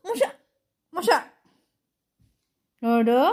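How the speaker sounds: high, loud calling to wake the pet. Real, not acted. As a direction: shout for the pet, sing-song. Loudly calling pet awake.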